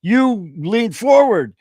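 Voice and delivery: high, loud indignant defence; self-righteous excuse, high and loud; mock protest. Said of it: "And I go".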